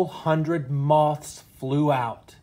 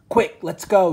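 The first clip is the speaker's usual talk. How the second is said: urgent, high, louder yell; 7 semitones higher, 7 dB louder than usual. Acted. Mister Bear hurrying his family away from the monster, urgent yell. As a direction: get everyone out now. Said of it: "yelled Mister Bear quickly".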